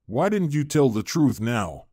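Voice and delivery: usual speaking voice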